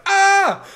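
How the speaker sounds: high, loud mock panic gasp; panicked gasp. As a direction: yelp like a baker caught out. Everyone else reacting in panic.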